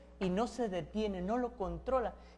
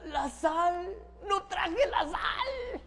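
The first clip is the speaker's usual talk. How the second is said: drawn-out, high cry of forgetting; acted high-pitched dismay; dismayed realisation; 7 semitones higher, much slower than usual. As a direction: wail it high and slow, as if it just hit you. Acted forgetful shopper realising at home.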